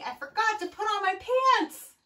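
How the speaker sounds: high, loud, quick admission; loud storybook dismay; crying out. A narrator's reading. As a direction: blurt it out high, like an embarrassed frog. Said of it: "cried Froggy".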